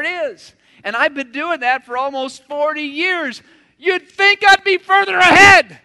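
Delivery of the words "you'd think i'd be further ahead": much higher, much louder exclaim; self-mocking outburst. Real, not acted. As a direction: throw the joke out big. Self-mocking joke about his progress.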